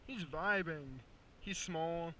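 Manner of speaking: ordinary talk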